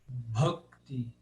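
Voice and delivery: "bhakti" low-pitched, with aspirated BH; correct Sanskrit pronunciation. Shown as a demonstration. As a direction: say "bhakti" as "bhok-ti". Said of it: "corretamente é". ("bhakti" grave, com o H aspirado)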